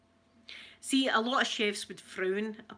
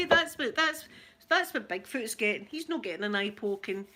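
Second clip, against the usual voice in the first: usual speaking voice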